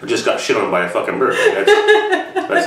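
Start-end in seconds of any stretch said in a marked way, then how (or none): 1.3-2.7 words break up in laughter; can't get it out for laughing. Real, not acted. Laughing to viewers about getting shat on.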